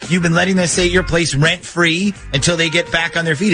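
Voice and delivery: usual speaking voice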